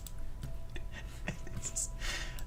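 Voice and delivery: soft, whispery giggling; helpless real giggle; giddy laughing. Real, not acted. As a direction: laugh breathlessly, with no voice. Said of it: "I'm in a bit of a giddy mood".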